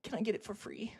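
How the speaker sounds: a light mock kiss, then a cheeky ask; cheeky freeloader with a smack of a kiss; imitating Hiro. Imitating Hiro asking the cafe for free food, cheeky. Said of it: "he used to go".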